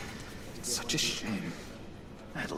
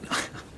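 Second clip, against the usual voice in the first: breathy little laugh; 8 dB quieter than usual. Acted. John's nervous little laugh.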